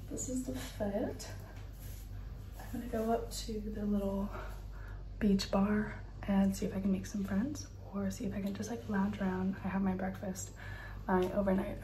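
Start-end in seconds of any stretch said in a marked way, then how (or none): 0.5-9.2 whisper. Real, not acted. Whispering plans.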